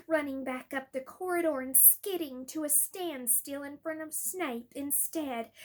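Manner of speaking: ordinary delivery, nothing marked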